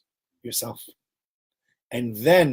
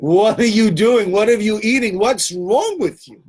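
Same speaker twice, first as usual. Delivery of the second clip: higher, louder, slow incredulous outburst; mock outraged disbelief; 7 semitones higher, 6 dB louder than usual. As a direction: scold him like he's lost it. Household reacting in disbelief.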